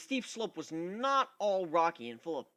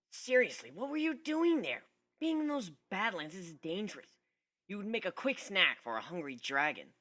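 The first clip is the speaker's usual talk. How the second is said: brash griffin, a bit higher; a hint of a gruff, cocky griffin; lightly voiced Gilda; 5 semitones higher than usual. A narrator's reading. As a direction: tough tomboy griffin scolding. Gilda's voice, narrator reading.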